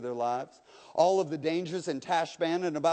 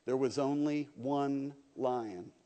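quiet lion voice; 4 semitones lower, 6 dB quieter, slower than usual. Acted. Lion corrects boy.

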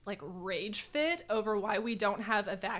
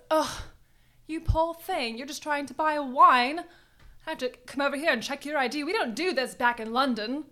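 put-on a rough, higher British accent from a friend who admits she can't do accents; 5 semitones higher, 8 dB louder than usual. Retelling the self-checkout clerk in a weak British accent. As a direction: play the cashier in a shaky British accent, a bit higher and louder. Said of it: "'cause I can't do accents".

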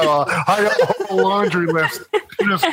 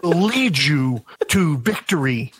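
Shatner impression. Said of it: "as I drop my Shatner".

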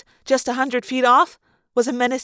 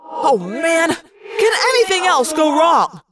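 frustrated yell; 4 semitones higher, 3 dB louder, slower than usual. A narrator's reading. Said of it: "Jake yelled in frustration".

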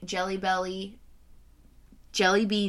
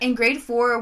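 ordinary talk

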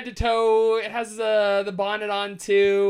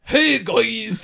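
Norseman accent, louder greeting; 6 dB louder than usual. Shown as a demonstration. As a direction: read it like a viking. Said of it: "Read it like a Norseman would read it".

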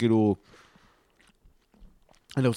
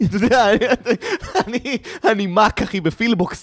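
high, giggly, stumbling through the words; laughing while talking; 10 semitones higher, 4 dB louder than usual. Real, not acted. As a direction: crack up mid-sentence as you tell it. Laughing through his own punchline.

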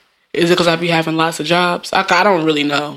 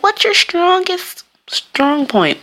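high, slow put-on interviewer voice; mock interviewer; 11 semitones higher, much slower than usual. Job interviewer asking about strengths, high mock-official voice. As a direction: draw the question out in a high imitation. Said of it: "they be like".

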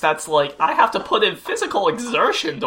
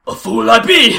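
dramatic hero voice; 4 semitones higher, 8 dB louder than usual. Retold or imitated. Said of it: "And he's like".

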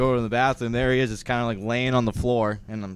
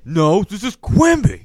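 slow goofy Patrick voice; 7 semitones higher, 8 dB louder, slower than usual. Retold or imitated. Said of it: "And SpongeBob it".

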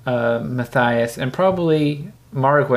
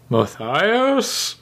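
giant's confused call; 7 semitones higher than usual. Acted. Giant grasps at the image.

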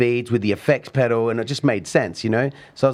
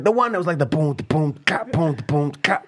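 imitated beatbox; 7 semitones higher than usual. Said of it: "He's like".

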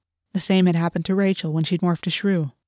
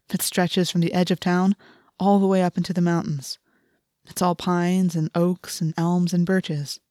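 ordinary delivery

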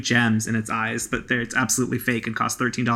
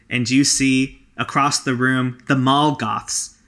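usual speaking voice

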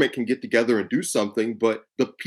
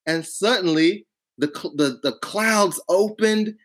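Southern accent; 7 semitones higher than usual. Shown as a demonstration. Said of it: "I should just talk like this".

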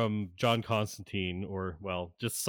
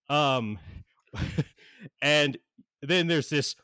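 ordinary delivery

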